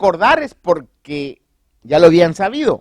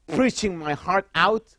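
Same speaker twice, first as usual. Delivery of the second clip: English phrase; 6 dB quieter, slower than usual. Shown as a demonstration. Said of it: "como dicen ahí".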